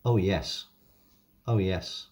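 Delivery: ordinary talk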